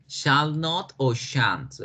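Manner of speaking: shall not o shan't said very slowly; slow teacher demonstration; very slow model. Shown as a demonstration. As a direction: spell it out slowly for students. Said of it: "Respetamos la misma oración".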